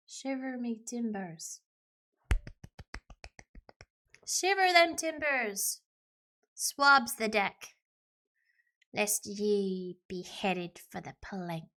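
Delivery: slow, drawn-out pirate lingo; pirate talk. Acted. Player warning of the plank, pirate talk. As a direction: roll it out like an old-time pirate. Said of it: "That's pirate talk done".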